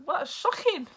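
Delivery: high, loud shocked gasp of a reply; retelling her own stunned reaction to the scene; shocked what. Retold or imitated. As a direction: throw it up high in disbelief. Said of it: "So I was like".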